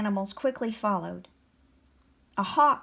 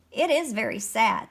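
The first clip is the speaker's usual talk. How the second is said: usual speaking voice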